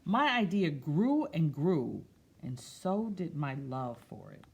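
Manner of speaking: slow storybook read-aloud; picture book voice; reading to children. Acted. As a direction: read it gently to a class.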